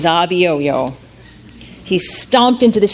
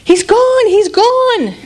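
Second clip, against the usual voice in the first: much higher, loud cheering; joyful crowd; 13 semitones higher, 5 dB louder, slower than usual. Acted. Townspeople cheering the monster's end, overjoyed. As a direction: shout it in relief. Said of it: "the people in the town came streaming".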